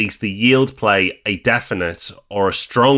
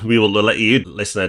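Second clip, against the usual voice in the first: ordinary talk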